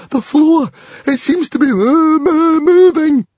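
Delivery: very high, loud stammer of terror; frightened gasp. A narrator's reading. Fishlegs sees the floor moving, gasping in terror. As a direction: stutter in mounting panic, stretching the last words. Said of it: "gasped Fishlegs".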